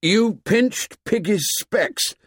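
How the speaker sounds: high, slow accusation; high-pitched, breathless indignation. A narrator's reading. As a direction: accuse him, worked up and short of breath. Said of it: "said Ralph breathlessly".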